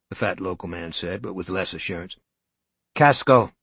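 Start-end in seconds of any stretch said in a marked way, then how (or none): 2.9-3.5 firmly. Acted. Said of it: "his wife said firmly".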